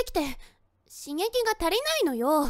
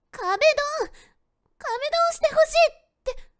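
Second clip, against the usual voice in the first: higher, louder flustered blurt; embarrassed outburst; 8 semitones higher, 4 dB louder than usual. Acted. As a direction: blurt it out, mortified. Finally blurts embarrassing wish.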